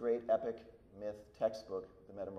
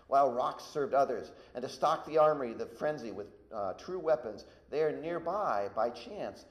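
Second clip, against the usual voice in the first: raised, emphatic epic narration; dramatic story reading; 5 semitones higher, 9 dB louder than usual. Acted. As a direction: read the attack aloud with rising force. Reads Ovid aloud to class.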